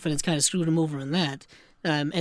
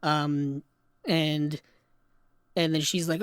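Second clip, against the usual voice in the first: ordinary talk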